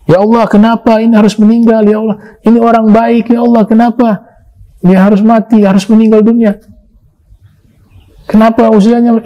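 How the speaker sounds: high-pitched, pleading cry to God over a death; mock wailing lament. Preacher imitating a mourner's forbidden lament, pitched high. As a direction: act out a mourner bewailing the loss, voice up high. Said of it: "itu namanya ratapan".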